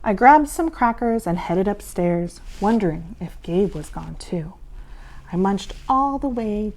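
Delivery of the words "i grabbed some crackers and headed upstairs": ordinary delivery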